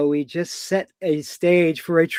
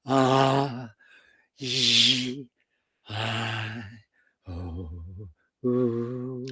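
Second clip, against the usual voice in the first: sustained soft vowels, lower than speech; five practice sounds; 6 semitones lower, 5 dB quieter, much slower than usual. Shown as a demonstration. Coach demonstrates a daily embodiment practice, low sustained vowel sounds. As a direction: intone each vowel separately and gently. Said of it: "with these five sounds".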